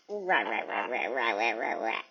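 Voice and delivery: repeated low rah calls mimicking the bird; imitating her pet's squawk. Showing viewers how her pet talks to her, mock squawking rahs. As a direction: parrot his rah rah chatter back. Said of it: "This is how he talks to me".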